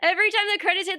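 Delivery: ordinary delivery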